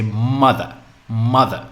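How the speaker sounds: "mother" with a weighty first syllable and falling second; stress on first syllable. Shown as a demonstration. Teacher repeating the noun for learners, first syllable of "mother" stressed. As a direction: lean hard on the start of "mother". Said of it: "focus on the first syllable".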